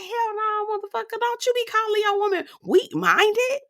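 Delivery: high mock scolding voice; imitating Diamond. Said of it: "She's like".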